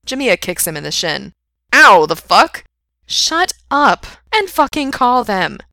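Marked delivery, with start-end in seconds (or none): 1.7-2.6 loud, startled yelp of protest; pained protest. A narrator's reading. Man kicked in the shin by friend, indignant. 3.1-5.6 high, loud, slow and emphatic; exasperated order. A narrator's reading. Friend orders him to call his boyfriends, exasperated.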